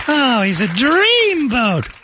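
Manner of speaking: high, slow swooning sigh; swooning mother. Retold or imitated. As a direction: melt over a movie star. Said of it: "Brady's mother swooned".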